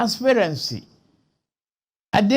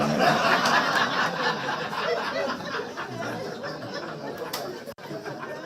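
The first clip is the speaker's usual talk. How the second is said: soft breathy laugh; 5 semitones lower, 7 dB quieter than usual. Real, not acted. Laughs after recalling lawyer's remark.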